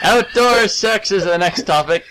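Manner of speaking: slow, drawn-out hawker's call; street vendor calling. Pretend. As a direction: sell it like peanuts at the ballpark. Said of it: "you're offering it like peanuts".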